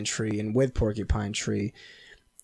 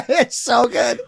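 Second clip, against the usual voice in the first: laughing; 10 semitones higher, slower than usual. Real, not acted. Raving about dad's sandwich.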